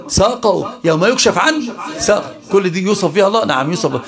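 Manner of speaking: ordinary talk, nothing marked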